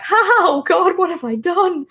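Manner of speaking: high, nervous laugh into dread; hammed-up nervous regret, pitched high; mock panic. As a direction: act out a comic "oh no" moment. Acting out own panic at project.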